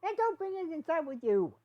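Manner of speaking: yelled. Acted. Hitch yelling at Izzy about the storm, full yell. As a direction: yell it at her over the storm. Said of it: "He then turned to Izzy and yelled".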